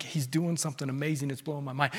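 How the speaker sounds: soft, low gentle coaxing; outwardly restrained. Acted. Pastor outwardly urging his church, soft and restrained. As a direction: hold it back, very softly. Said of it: "And outwardly, I'm like".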